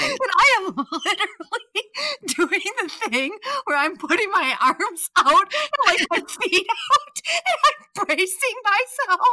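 high voice breaking into real laughter; laughing while telling. Real, not acted. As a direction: struggle to narrate through the giggles. Cracking up while retelling panic.

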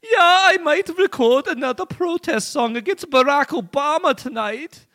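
put-on high, loud German accent. Kim Dot Com answering the hackers in a German accent. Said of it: "a German accent".

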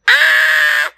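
high, loud held yelp; acted cry of a stung cub; scream. Bees swarm the baby bear, high loud scream. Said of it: "they see him all over, and he goes".

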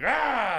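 high, loud shout to scare off an animal; sudden loud high yell. Retold or imitated. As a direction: jump up and bark at it. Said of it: "I stand up and I'm like".

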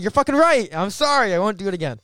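loud, high-pitched mock pleading; over-the-top frantic sorry; hammed-up apology. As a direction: shout an exaggerated apology, easing off slightly. Acted apology to angry fan.

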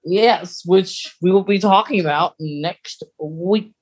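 normal talking voice

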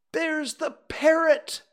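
high, slow, loud exclamation; excited cry. A narrator's reading. Scrooge spotting the parrot, crying out in delight. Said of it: "cried Scrooge".

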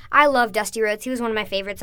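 ordinary delivery

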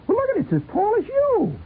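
very high, marvelling; amazed Sheila. Pretend. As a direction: gasp at how tall it is.

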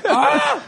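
high, loud laughing yell; a comic scream of despair; frustrated yell laughing. Retold or imitated. Reacting to roosters at dawn, a high laughing yell.

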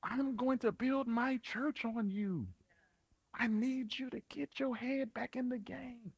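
Jesus restoring Peter, softly reassuring; Jesus encouraging Peter. Retold or imitated. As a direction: coax him back gently. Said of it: "and told him".

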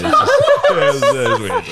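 squeaky high laugh through the words. Real, not acted. Rugby player recalling nude scrummaging, words squeezed out laughing. Laughing at his own story.

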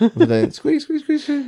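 very high mock squeaks; squeaky tricycle noise. Mimicking the creepy guy's tricycle, high squeaks. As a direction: squeak like a tiny tricycle wheel.

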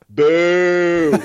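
loud, high mock boo; imitated crowd boo. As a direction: boo like a whole arena. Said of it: "Whole crowd".

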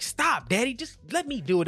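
a hint of a fussy toddler pushing daddy away; mock toddler protest. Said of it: "We're like".

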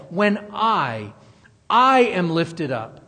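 "I" hit hard, loud and drawn out; stress on I. Retold or imitated. Preacher quoting Jesus stressing that it's him, heavy stress on "I". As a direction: punch the word "I" slowly and firmly. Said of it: "He's even emphasizing that it's him".

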